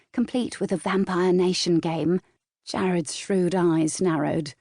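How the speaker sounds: ordinary delivery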